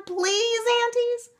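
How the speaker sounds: put-on wheedling, pleading kids; begging. A narrator's reading. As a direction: beg in a high voice, stretching out "please". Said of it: "begged Meg and Sean".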